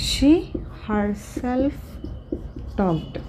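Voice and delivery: word by word, soft and spaced out; slow dictation. Shown as a demonstration. Teacher dictating the sentence for students to note down, soft and spaced. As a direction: dictate it so they can write it down. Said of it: "అది కూడా note చేద్దాం".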